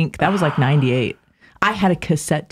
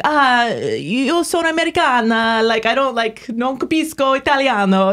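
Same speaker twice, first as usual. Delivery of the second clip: lilting Italian, pitched higher and slow; Italian accent; 6 semitones higher than usual. Retold or imitated. Telling an Italian lady she is American, in a light Italian accent. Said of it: "I'm better at the Italian too".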